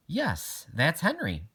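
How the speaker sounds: a friendly principal, lifted a touch; principal's voice. A narrator's reading. Miss Shelton to Iris, lightly voiced principal.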